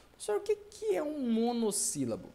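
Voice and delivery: high-pitched pupil voice; curious student. Acted. Student asking teacher for a definition, high voice.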